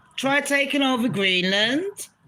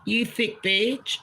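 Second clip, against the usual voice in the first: ordinary talk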